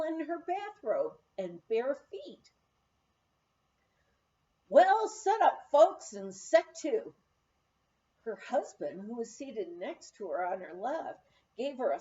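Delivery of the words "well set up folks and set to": slow, loud folksy announcement; hearty call. A narrator's reading. Cook summoning the guests to eat, loud hearty call. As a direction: boom it out to the whole table.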